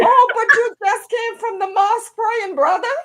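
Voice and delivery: ribbing a churchgoer for cursing; playful mock outrage at a brother fresh from the mosque; teasing reproach. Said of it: "I'm like".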